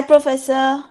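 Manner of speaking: ordinary talk, nothing marked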